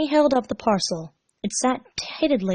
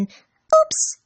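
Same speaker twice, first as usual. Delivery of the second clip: very high, loud squeak; high squeaked oops; embarrassed oops; 6 dB louder than usual. Acted. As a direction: blurt a caught-out oops. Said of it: "muttered an".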